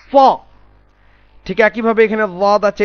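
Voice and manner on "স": স as a bold, rounded "saw"; heavy sound. Shown as a demonstration. Teacher demonstrating Sad to students, heavy স. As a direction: pronounce স full and heavy. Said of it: "এটা উচ্চারণ হবে".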